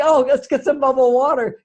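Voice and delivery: a hint of boyish eagerness over the treat; eager. Husband keen on bubbly water, mildly excited. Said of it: "So I'm always thinking".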